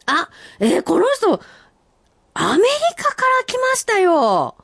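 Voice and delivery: high, bright announcement of a surprise; 「アメリカから」と声を跳ね上げて; excited. Real, not acted. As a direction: say it like big news, voice jumping up.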